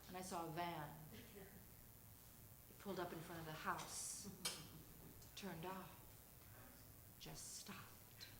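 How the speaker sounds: low, quiet, tense storytelling; hushed suspense. Real, not acted. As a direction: draw them in, spooky and hushed. Frightening night, van pulls up.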